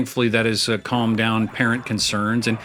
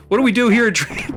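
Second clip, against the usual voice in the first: show intro call; 9 semitones higher than usual. Pretend. Announcing the show's name.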